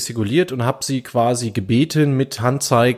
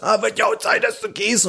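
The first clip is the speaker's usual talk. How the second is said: high, sneering mock yell; 8 semitones higher than usual. Driver imitates the man jeering as he leaves. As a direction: jeer after him like the hothead. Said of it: "brüllte er mir noch was".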